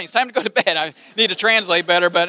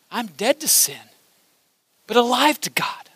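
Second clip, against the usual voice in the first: fading to whisper. Acted. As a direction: let it fade to a hush. Preacher fades to whisper.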